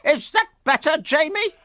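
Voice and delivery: very high, bossy clucking hen voice; clucking nurse chicken. Acted. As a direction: bustle in like a fussy hen nurse. Florence asks sheep.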